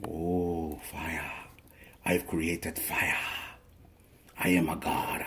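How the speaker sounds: hushed, awed whisper over the flame, slow; Castaway fire scene. Retold or imitated. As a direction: breathe it in awe like a castaway who just made fire. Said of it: "that's from the movie Castaway".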